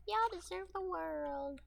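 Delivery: high, slow, sing-song delivery; a silly high drawn-out voice; playful put-on voice. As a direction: stretch it out high and slow for fun. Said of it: "I'm just saying it like that because it's kind of fun to say it like that".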